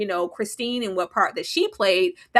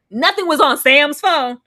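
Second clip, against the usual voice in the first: outraged; 7 semitones higher, 7 dB louder than usual. Real, not acted. Friend ranting at police about the empty phone, outraged. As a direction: spit it out in disbelief.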